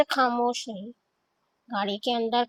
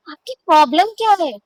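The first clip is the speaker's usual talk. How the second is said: irritated; 6 semitones higher, 8 dB louder, faster than usual. Acted. She snaps, pulling hand free.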